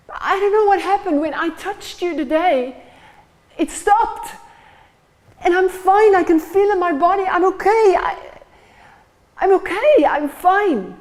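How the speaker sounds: high and loud, bursting with amazement; overjoyed relief. Acted. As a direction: she can't believe she is healed. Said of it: "she begins to explain".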